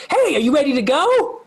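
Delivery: small elephant friend asking the party to leave; Lulu's voice. Acted. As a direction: chirp it out brightly. DM voices Lulu the NPC.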